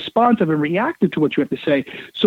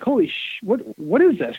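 startled mock exclamation, soft and high; shocked; 4 semitones higher, 3 dB quieter than usual. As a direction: act suddenly stunned. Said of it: "they're like".

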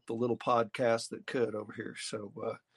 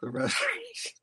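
squeaky high mock quote; lightly mock the word restoration; a sudden high silly voice. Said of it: "mock quote of the old boy".

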